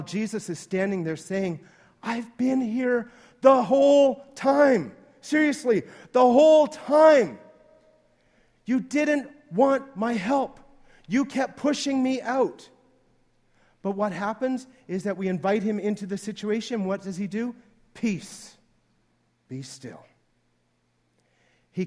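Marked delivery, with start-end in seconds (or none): 2.0-12.7 slow, emphatic, raised-pitch insistence; loud, drawn-out exasperation; exasperated protest. Acted. Jesus protests he was always there. 17.9-20.1 soft, settling hush; quiet command, dropping low and very soft; calming command. Acted. Jesus calms the storm.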